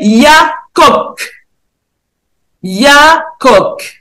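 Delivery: "Yakok" said slowly, syllable by syllable; spelled out. Shown as a demonstration. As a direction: sound out "Yakok" deliberately. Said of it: "je lis les consonnes et les voyelles". (« Yakok » articulé lentement, syllabe par syllabe)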